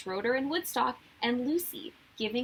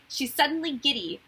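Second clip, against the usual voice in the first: plain reading voice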